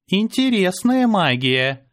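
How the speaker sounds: slow, high, lazily tossed off; lazy remark. A narrator's reading. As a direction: drawl it with bored interest. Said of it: "лениво бросила она".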